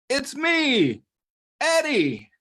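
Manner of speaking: very high-pitched eager cry; excited calling out. A narrator's reading. Eddie revealing himself to the bear, high excited cry. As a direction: call it out high, in a small character's voice.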